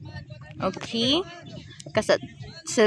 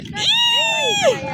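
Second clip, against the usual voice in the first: very high drawn-out squeal. Real, not acted. Spectator reacting to a player's try, high squeal. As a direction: let out a long high squeal.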